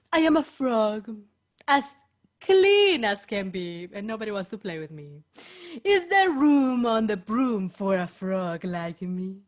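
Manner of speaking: low, gulping frog voice. Acted. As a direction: croak it like a lonely frog. The frog speaks.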